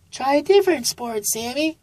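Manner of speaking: high, gentle mom voice; high-pitched mom encouraging her son; mother sloth voice. A narrator's reading.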